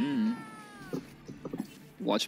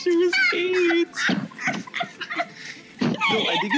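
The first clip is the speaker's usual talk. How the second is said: laughing shout; 3 dB louder than usual. Real, not acted. Laughing outburst in chat.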